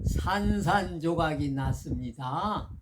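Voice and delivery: each syllable drawn out, slow and weighty; slow solemn reply. Retold or imitated. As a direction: deliver it slowly like a koan answer. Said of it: "하고 방거사가 얘기해".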